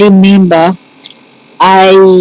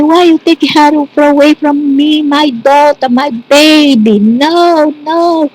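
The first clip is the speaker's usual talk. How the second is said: high, keening cry of a grieving mother; a mother crying out over her lost baby, wailing high; 9 semitones higher than usual. Retold or imitated. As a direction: wail it up high at the sky. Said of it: "my sister wailing".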